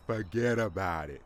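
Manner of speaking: lightly voiced New Jersey wiseguy brushing it off; a hint of a gruff New Jersey mobster; mock New Jersey dismissal; New Jersey tough guy. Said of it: "an angry fat man from Jersey".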